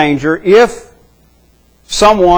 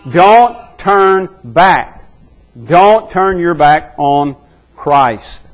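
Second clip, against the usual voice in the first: slow exhortation; much slower than usual. Acted. Preacher's exhortation.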